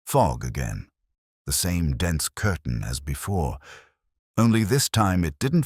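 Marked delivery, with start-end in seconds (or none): none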